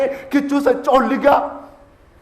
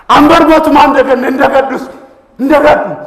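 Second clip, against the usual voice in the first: loud, raised declamation; impassioned vow; 3 semitones higher, 11 dB louder than usual. Acted. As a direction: proclaim it forcefully to the crowd. Defiant vow at poem's climax. (sagalee guddaan labsuu)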